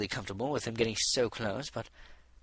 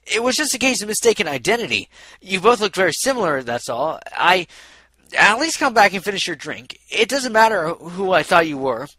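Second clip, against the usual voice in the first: raised, higher voice begging her back; loud pleading; 8 semitones higher, 10 dB louder than usual. A narrator's reading. Stallion loudly begs the mayor to come back and stay. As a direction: plead hard, loud and urgent.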